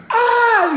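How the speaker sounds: high loud yelp; hammed-up ah of hurt; mock cry of pain. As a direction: imitate her howl of pain. Imitating girl crying out.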